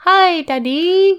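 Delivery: put-on high, slow sugary little-boy voice; wheedling son. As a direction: coo it like a child sucking up. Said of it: "let's reenact the phone call".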